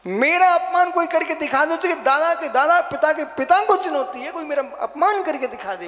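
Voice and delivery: defiant challenge. Retold or imitated. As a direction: throw down a loud challenge to all comers.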